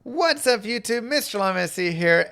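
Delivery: YouTuber intro. Acted. Video intro greeting.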